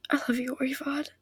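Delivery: whispered through tears; tearful whisper. Acted. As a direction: whisper it to her brother, overcome. Tearful reunion with her brother.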